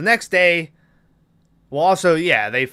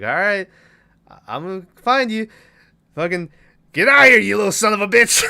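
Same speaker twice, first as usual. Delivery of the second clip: raised, mocking doll voice that snaps into a fast loud cuss; Chucky voice; 8 semitones higher, 7 dB louder, faster than usual. Killer doll hunting the boy in the closet, rising mock snarl. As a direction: growl it out like the killer doll losing patience. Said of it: "he's like".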